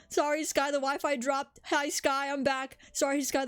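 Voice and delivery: acting out texts. Traveler updating friend each time Wi-Fi drops, mock apologetic. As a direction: replay your repeated apologies, lightly hammed up. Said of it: "and I'm like".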